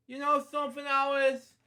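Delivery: a sudden eager aside to her; excited aside. Real, not acted.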